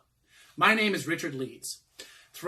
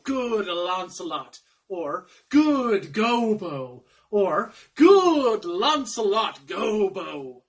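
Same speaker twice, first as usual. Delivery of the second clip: slow, drawn-out names in a coaxing stage voice; tempting fiend; 3 semitones higher, 8 dB louder, slower than usual. Acted. Fiend urges servant to run away, theatrical tempting. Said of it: "The fiend is at mine elbow and tempts me".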